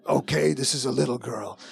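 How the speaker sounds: mock actor's unchanged put-on voice. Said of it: "like a little girl".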